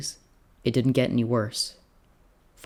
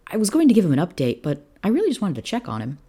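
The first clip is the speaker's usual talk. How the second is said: put-on young officer, concerned; 8 semitones higher, 4 dB louder than usual. Young officer checking on his general, high and concerned. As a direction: explain hurriedly, then ease off.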